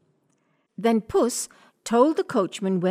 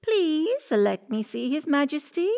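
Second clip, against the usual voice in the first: cat voice; 6 semitones higher than usual. Acted. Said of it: "said Puss".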